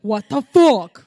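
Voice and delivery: slow, booming what the fuck; loud disbelief. Real, not acted. Friend reacting to the leftover candy, loud and slow.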